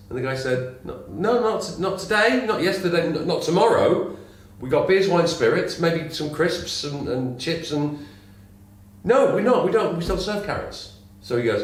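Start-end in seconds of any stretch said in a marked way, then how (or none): 0.8-10.9 higher, mildly exasperated barman; a hint of a fed-up barman; higher barman losing patience. Joke, barman refuses again.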